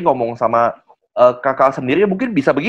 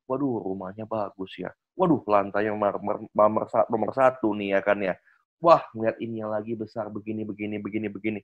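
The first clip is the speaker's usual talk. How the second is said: hushed admiring; 6 semitones lower, 8 dB quieter than usual. Retold or imitated. Guest admiring the house.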